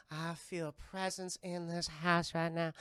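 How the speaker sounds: thick, slow Southern drawl; the seer's unhurried Southern voice; Zelda's Southern accent. Retold or imitated. Seer sensing a presence in the house, thick Southern accent. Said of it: "a very thick Southern accent".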